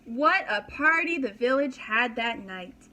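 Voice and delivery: ordinary delivery, nothing marked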